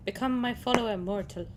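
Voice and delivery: lightly imitating an Irish lilt on mortal; a hint of Irish, copying the game voice; mock Irish accent. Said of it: "But he sounds Irish".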